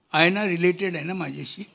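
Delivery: ordinary talk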